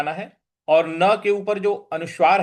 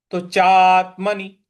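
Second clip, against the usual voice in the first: चात्मनि said louder with weight on त; stressed model; 5 dB louder, slower than usual. Shown as a demonstration. Teacher models the right stress for students. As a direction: lean into चात्मनि firmly. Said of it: "इस प्रकार से होगा".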